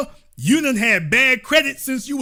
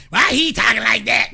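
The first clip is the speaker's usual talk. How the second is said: a complaining churchgoer's outraged shout; offended church lady, outraged shout; mock churchgoer complaint; 3 dB louder than usual. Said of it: "and you upset and that".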